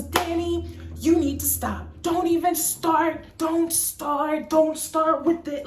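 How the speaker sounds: loud, high, angry warning; the first engineer shutting her down angrily; belligerent boss. Retold or imitated. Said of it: "he just gets belligerent".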